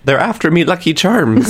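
high, lilting leprechaun Irish brogue; Irish accent. Retold or imitated. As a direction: sing it like the cereal leprechaun. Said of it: "Is that what leprechauns do?".

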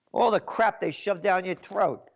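normal talking voice

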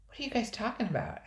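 curious. A narrator's reading. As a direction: ask brightly, curious. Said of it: "asked Nicole curiously".

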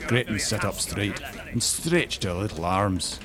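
ordinary delivery

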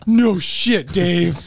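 laughing amazement; delighted disbelief. Retold or imitated. Realizing the coworker owns the dock, loud delighted shout. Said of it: "And I was like".

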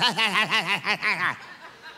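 rapid imitated giggle, like a teasing child; mock a naughty kid's gleeful laugh; mischievous kid's laugh. Grandkid coming at you with dirty hands, mischievous mock laugh.

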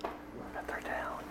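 breathy stage whisper. Pretend. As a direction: whisper it secretly. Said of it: "why are we whispering?".

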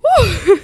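loud high whoop; loud woo. Real, not acted. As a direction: let out a big woo. Exclaims about rough week.

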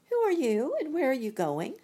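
high stork voice calling down. Acted. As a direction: squawk the question as a stork. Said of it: "asked the stork".

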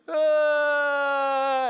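high, loud fake wail; mock crying wail. Pastor imitating his childhood crying, hammed-up wail. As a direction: wail like a kid crying on cue. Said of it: "flat out cry".

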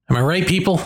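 usual speaking voice

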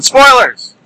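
sudden high, loud attention-grabbing call; really loud. Shown as a demonstration. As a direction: blurt it loudly to catch wandering listeners. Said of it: "I said it really loud that time to get your attention".

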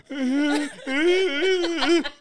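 high, drawn-out fake sobs; mock crying. Comic crying while the clock runs, mock sobbing. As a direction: keep up a pretend wail. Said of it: "I'm still over there".

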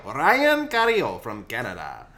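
put-on slow, loud Mexican accent. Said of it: "to have a Mexican accent".

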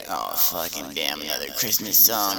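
fed-up grumble. Retold or imitated. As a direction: groan at yet another carol. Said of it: "you're just like".

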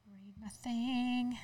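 slow, drawn-out mock repeat; slow mocking echo. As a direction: drag the words out mockingly. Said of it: "Read your thing".

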